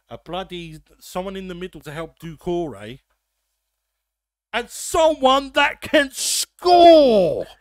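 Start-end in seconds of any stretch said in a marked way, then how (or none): 4.5-7.5 ranting shout. Real, not acted. Ranting about Palace transfer needs.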